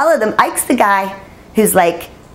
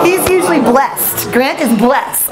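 slow, emphatic; 6 semitones higher, slower than usual. Retold or imitated. Ike explaining grudgingly.

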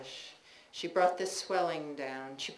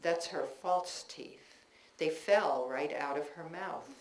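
ordinary delivery

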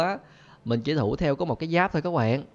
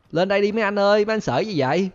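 higher, louder teasing call; taunting call; 7 semitones higher, 4 dB louder than usual. Real, not acted. Taunting the game police, loud teasing call. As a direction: dare them to come up.

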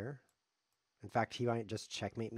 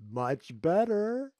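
very slow, high and loud; drawn-out, crowing satisfaction; gloating delight; 10 semitones higher, 10 dB louder, much slower than usual. Real, not acted. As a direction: stretch the words out in triumph. Relishes taking a free queen.

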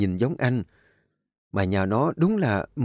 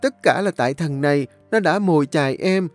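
high, whining, tearful plea; cornered girl begging the young master, wailing; wailing loudly; 8 semitones higher, 3 dB louder than usual. A narrator's reading. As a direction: wail and blame the other man. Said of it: "nó liền bù lu bù loa".

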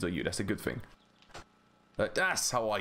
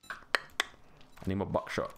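slow breathy whisper of "I need more buckshot"; much slower than usual. Pretend. Asking for ammo, whispered.

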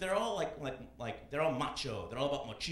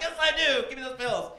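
high, loud eager reply; hammed-up eagerness; mock eager yes; 10 semitones higher, 8 dB louder than usual. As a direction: beg for the pills. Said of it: "I'm like".

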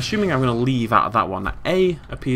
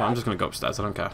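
normal talking voice

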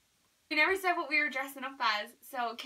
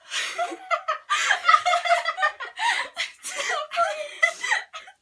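shrieking laugh; 8 dB louder than usual. Real, not acted. After the burning shot.

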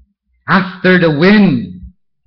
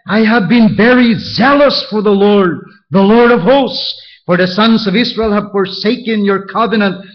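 fervent Elijah; 6 semitones higher, 4 dB louder than usual. Acted. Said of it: "And he said".

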